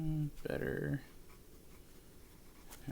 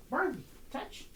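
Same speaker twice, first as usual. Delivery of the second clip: high dog cue; 10 semitones higher, slower than usual. Real, not acted. Calling dog to do touch.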